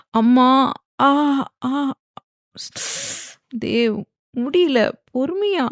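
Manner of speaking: high, halting cries of pain. A narrator's reading. Wife begs husband to be patient, pained and pleading. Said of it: "Theekshanya வலியில்".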